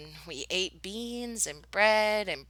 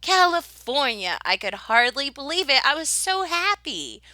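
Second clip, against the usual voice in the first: excited; 6 semitones higher, 4 dB louder than usual. A narrator's reading. Narrator overjoyed at arrival.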